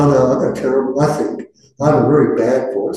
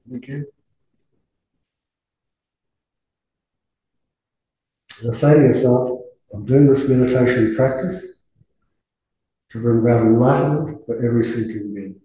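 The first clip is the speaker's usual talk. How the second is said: guided meditation. Acted. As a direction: ease the group gently into stillness.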